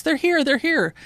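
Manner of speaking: high-pitched excited cry, not full volume; mock yell of an excited caller; retold scream. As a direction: imitate a caller crying out, pitch jumping up. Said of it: "she screams".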